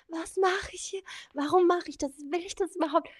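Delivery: high, half-acted panicked questions; mock panic. Quoting her pre-flight panic to the host, high and worried.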